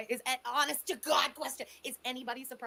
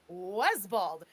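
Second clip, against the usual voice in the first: condescending; 5 semitones lower, 6 dB louder than usual. Retold or imitated. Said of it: "pokes her condescendingly ... and goes".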